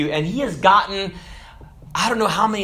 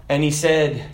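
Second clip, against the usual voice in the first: ordinary delivery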